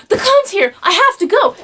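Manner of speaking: high, loud thrilled outburst; excited child shouting. Retold or imitated. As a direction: burst out like a kid who can't wait. Retold child spots the clown.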